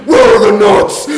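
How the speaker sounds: loud, high laughing shout; loud demand. Pretend. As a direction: yell the question. Mock interrogation.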